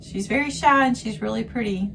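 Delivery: she's really pretty said up high and bright. Retold or imitated. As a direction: lift the voice to quote her. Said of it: "She says".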